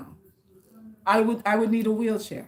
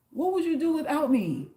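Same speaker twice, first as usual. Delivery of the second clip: ordinary talk, nothing marked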